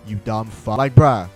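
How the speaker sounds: usual speaking voice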